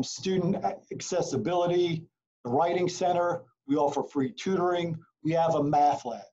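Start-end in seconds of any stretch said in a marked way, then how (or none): none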